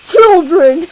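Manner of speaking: loud, sharp call; scolding shout. Acted. Scolding the quarreling kids with a loud call. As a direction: shout to stop them.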